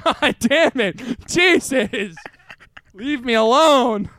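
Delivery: high loud mock-offended yell; mock outrage. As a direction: storm off in fake huff.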